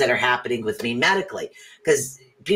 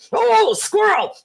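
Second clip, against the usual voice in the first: sudden squirrel excitement; 5 dB louder, slower than usual. Pretend. Dog voice distracted by squirrel.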